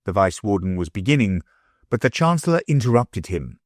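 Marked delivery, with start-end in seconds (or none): none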